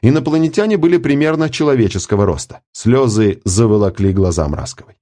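ordinary delivery, nothing marked

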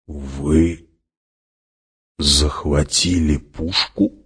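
slow, halting question, rising at the end; disbelieving. A narrator's reading. Hawat cannot believe they seized the gun, slow disbelief. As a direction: ask it slowly, as if doubting your own ears. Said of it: "не веря своим ушам".

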